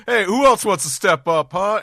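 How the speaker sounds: loud high taunting; cocky guard taunt. Retold or imitated. Cowardly guard taunting the crowd, loud and high. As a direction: jeer at the crowd. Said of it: "royal guard here shoots one person's like".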